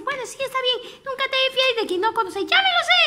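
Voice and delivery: put-on high eager child voice; eager little turtle offering to help her mother; little tortoise voice. Little turtle answering mama, high and eager.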